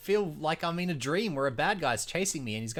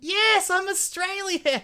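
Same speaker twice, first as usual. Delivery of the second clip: high, slow mock Aussie voice; hammed-up Australian twang; Australian accent; 12 semitones higher, 4 dB louder, much slower than usual. Answers in put-on voice.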